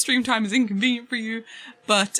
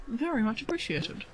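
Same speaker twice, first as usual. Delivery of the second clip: softer echo of the thanks; soft repeat; 8 dB quieter than usual. Real, not acted. Streamer repeats her thanks to the donor, softly to herself. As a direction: say it again under the breath.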